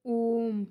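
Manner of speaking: ओम with a long, held-out ओ; prolonged vowel. Shown as a demonstration. Teacher demonstrating a pluta vowel to students, ओम drawn out long. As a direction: stretch the ओ of ओम to triple length. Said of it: "उसका तीन गुना समय लगता है".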